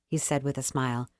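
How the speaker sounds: ordinary delivery, nothing marked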